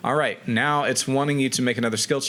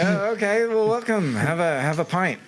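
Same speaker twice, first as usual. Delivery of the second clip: cheery innkeeper; 7 semitones higher than usual. Acted. Innkeeper voiced welcoming hobbits.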